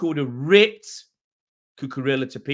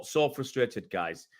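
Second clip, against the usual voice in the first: frustrated; 6 semitones lower, 7 dB quieter, slower than usual. Real, not acted. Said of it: "I'm that frustrated".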